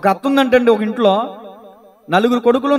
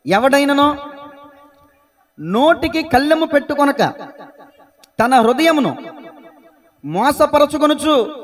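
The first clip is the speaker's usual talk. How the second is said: raised, measured reading in short phrases; slow, declaimed Bible verse; scripture reading; 3 semitones higher than usual. Real, not acted. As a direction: read the verse out deliberately, pausing after each phrase. Reads scripture aloud.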